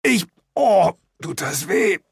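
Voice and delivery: high, halting, pained voice; acted whimpering groan. A narrator's reading. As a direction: moan through the hurt. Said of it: "ächzte ich".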